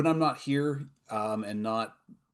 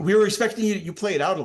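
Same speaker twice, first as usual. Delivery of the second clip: excited; 10 semitones higher, 11 dB louder than usual. Real, not acted. Excited group banter after callout.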